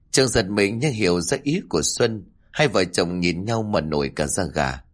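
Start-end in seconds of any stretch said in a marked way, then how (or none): none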